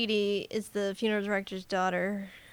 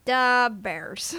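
put-on punchy Chicago sports-fan accent; broad mock Chicago accent; 4 dB louder than usual. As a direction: hit it like a Chicago superfan. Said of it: "I'm a cop from Chicago".

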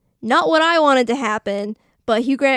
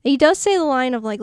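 ordinary delivery

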